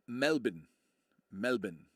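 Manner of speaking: Melbourne as MEL-bin; Aussie pronunciation. Shown as a demonstration. Streamer shows viewers the local way, Melbourne said MEL-bin. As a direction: say Melbourne with a swallowed second syllable, "MEL-bin". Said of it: "was how to pronounce".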